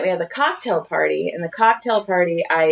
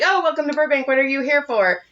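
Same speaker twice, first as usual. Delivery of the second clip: chirpy host greeting; 6 semitones higher than usual. Retold or imitated. Retold greeting passersby.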